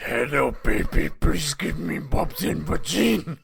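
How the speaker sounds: put-on loud, slow Indian-accented monster; Curry Monster voice. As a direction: drag it out in a hammy Indian accent. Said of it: "called Curry Monster".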